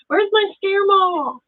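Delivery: put-on high, slow little-child voice; granddaughter's voice. Grandma mimicking granddaughter's call, high child voice. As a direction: squeak it out like a toddler. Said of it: "She's banging on the wall".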